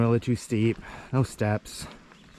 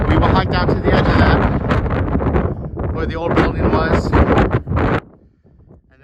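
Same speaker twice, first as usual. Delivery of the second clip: loud, higher voice over strong wind; shouting over wind; 8 semitones higher, 11 dB louder, slower than usual. Real, not acted. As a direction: call out so you're heard over the gusts. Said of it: "You probably can't hear me from the wind".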